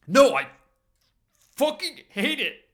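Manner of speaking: loud, high, slow outburst. Acted. Max bursting out to Richie about the gift, loud and high. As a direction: spit it out hating every word.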